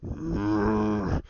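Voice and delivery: low monster growl; Hulk. Retold or imitated. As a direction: growl deep like an annoyed beast. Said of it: "Hulk just looks at him and goes".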